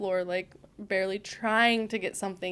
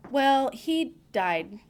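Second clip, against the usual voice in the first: awkward slow; 5 semitones higher, 6 dB louder, much slower than usual. Retold or imitated. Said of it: "I was like".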